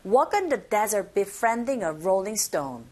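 slow model, punching "Walk", "desert", "befriending", "rolling stone"; content words stressed. Shown as a demonstration. Teacher models for learners, slow, "Walk" and "desert" stressed. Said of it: "머물지 마시고 빨리 넘어가시면".

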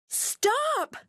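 high wailing plea; wailed. Acted. Molly wails at Taffy Bogle running off, high wail. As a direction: wail it after the runaway dog. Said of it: "wailed Molly".

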